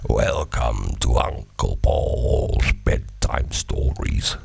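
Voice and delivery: low, slow, whispered horror host; spooky radio host. Retold or imitated. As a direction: creep it out like a spooky storyteller. Imitating spooky radio show intro.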